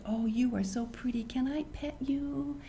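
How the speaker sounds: half-whispered sweet talk; gentle. Shown as a demonstration. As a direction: ask tenderly in a hushed voice. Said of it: "then you talk gently to 'em".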